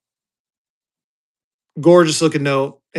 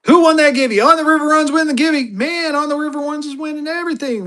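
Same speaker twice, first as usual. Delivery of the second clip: real, sudden high-pitched register; 10 semitones higher than usual. Real, not acted. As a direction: voice jumped way up high. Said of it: "my voice gets all high-pitched".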